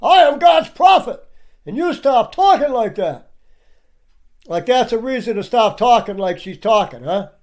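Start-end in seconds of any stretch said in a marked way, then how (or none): 0.0-3.3 high, mock-indignant proclamation; raised, hammed-up indignation; mocking parody. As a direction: imitate an outraged self-declared prophet. Parodies clips of wizard and prophet.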